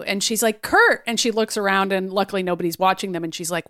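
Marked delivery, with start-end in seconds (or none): 0.6-1.0 startled gasp of his name; high surprised exclamation. Retold or imitated. Surprised Wanda sees Kurt.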